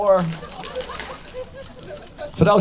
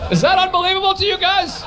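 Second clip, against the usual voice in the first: laughing disbelief; 11 semitones higher than usual. Real, not acted. Amazed at Picasso owning car.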